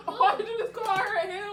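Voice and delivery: high, loud laughing question. Real, not acted. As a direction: crack up while asking. Everyone cracking up at slip.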